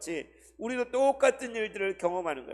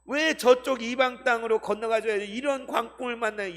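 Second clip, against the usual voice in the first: resentful complaint; 6 semitones higher, 4 dB louder than usual. Acted. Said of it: "예수님 원망".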